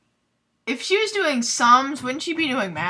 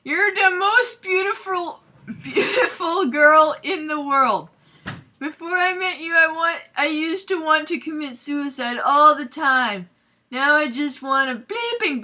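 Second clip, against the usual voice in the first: melodramatic lovesick rant; 7 semitones higher, 5 dB louder than usual. Acted. Draco pours out his love to Ebony in a high melodramatic voice. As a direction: ham up the tearful love speech. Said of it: "he shouted sadly".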